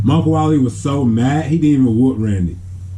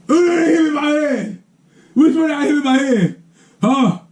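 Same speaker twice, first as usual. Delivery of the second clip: much higher, half-crying furious uncle yelling; mock angry yelling. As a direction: yell like a hurt uncle hunting the culprit. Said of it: "he started yelling".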